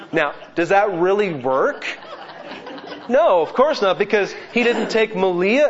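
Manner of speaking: high, loud laughing disbelief. Real, not acted. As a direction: laugh off the silly idea. Laughs at own absurd example.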